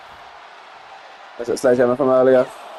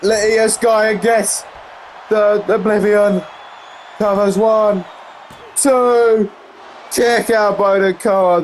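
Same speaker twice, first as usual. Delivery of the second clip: raised-pitch wrestling call; excited play-by-play; 6 semitones higher than usual. Real, not acted. Commentator calling the Oblivion and a two count, excited play-by-play. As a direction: build excitement through the pin count.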